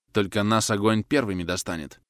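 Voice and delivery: ordinary delivery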